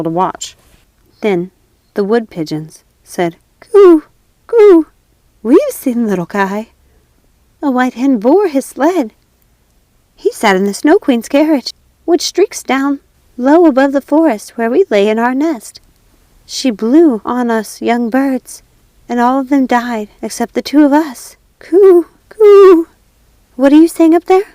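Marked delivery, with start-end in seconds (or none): 3.6-22.9 high, loud birdlike cooing voice; cooing pigeons. Acted. 23.6-24.4 high, loud call upward. Acted. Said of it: "Gerda called out".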